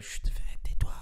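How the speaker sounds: hushed shushing whisper; urgent whisper telling wife to hush. Real, not acted. Hushing his wife.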